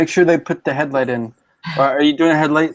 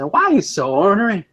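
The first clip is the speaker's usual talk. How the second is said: higher-pitched put-on Southern line; Southern accent; 6 semitones higher than usual. Approaching someone grumpy, playful Southern accent. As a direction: imitate a local Southerner. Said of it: "the key word is using the, uh, uh, the words they use around here".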